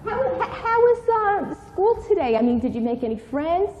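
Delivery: high, eager mother voice; a mother eagerly asking about school. Acted. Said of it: "this is not the way you're supposed to behave".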